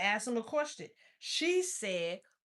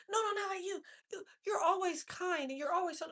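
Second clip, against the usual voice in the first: much higher, imitating a schoolmate; mock girl's voice; 8 semitones higher than usual. Retelling the schoolgirl's reply, in a higher mock voice. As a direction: squeak it up as the pleading girl.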